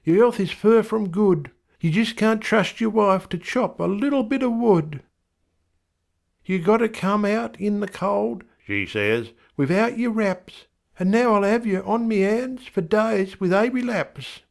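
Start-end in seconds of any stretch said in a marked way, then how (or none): 0.0-8.4 much higher wife voice; an indignant wife, higher; wife's voice. A narrator's reading. Wife scolding husband Bill, much higher. 9.5-14.4 much higher wife voice; an indignant wife, higher; wife's voice. A narrator's reading. Wife scolding husband Bill, much higher.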